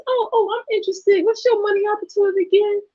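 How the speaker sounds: high, quick fake eagerness; fake eager. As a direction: play dumb and curious to bait them. Fake interest to scammer.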